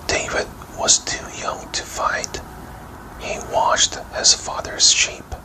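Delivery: ordinary delivery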